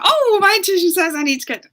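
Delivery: high, loud, rushed exclamation; mock-keen inner voice, quick and loud; gushing self-justification. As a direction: blurt it out in a high, eager rush. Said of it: "you know, and you're like".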